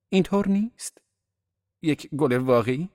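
high-pitched, rising appeal; appealing question. A narrator's reading. Daisy appeals to Miss Baker. (پرسش تأییدطلبانه با صدای زیر)

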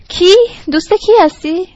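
loud, high, indignant phone demand; nearly shouting. A narrator's reading. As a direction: raise the voice sharply at a caller.

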